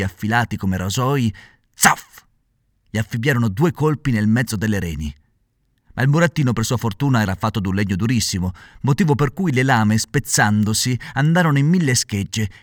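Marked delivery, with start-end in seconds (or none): none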